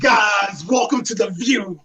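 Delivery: loud, slow host welcome with a laugh; mock talk show host. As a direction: ham up a TV host's opening.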